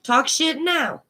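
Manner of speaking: put-on old Cajun grandpa. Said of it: "old Cajun".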